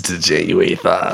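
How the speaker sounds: low put-on Boston accent. Friend stepping in for the host, Boston accent. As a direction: drop the r's in a low Boston voice. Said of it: "I can't do a Boston voice".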